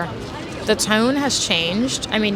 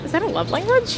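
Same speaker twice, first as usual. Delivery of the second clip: squeaky, much higher quizzical aside; puzzled aside; 13 semitones higher than usual. Real, not acted. Talking to viewers, a sudden high puzzled aside. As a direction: ask yourself mid-thought, pitched way up. Self-questioning aside mid-sentence.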